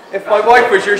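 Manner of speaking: projecting to the back of the room. Real, not acted. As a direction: speak up so the back can hear.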